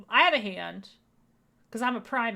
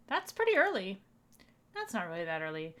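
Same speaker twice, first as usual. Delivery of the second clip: normal talking voice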